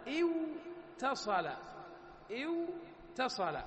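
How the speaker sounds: اتصل drawn out very slowly, the doubled ت stressed; slow demo pronunciation. Shown as a demonstration. Teacher to students, slow careful اتصل. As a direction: spell out اتصل syllable by syllable. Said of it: "مثل ما أنط-، أنطقها أنا".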